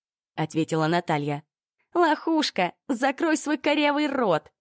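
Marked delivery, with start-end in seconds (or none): 1.9-4.5 high, slow spiteful snarl; angry insult. A narrator's reading. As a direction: spit the insult at her. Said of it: "Ринулась в свою сторону Ольга". (злобный высокий выкрик)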